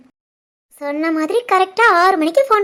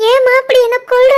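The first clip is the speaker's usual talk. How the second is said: higher, louder, pleading against her; tearful plea; 6 semitones higher, 5 dB louder than usual. Acted. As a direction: cry out in protest to mother. Daughter pleads with her mother.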